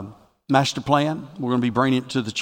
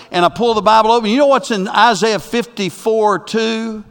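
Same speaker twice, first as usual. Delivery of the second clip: raised pitch, building in volume; fervent preaching; 8 semitones higher, 5 dB louder than usual. Real, not acted. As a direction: preach the discovery, drawing out the scripture. Preaches the discovery at full force.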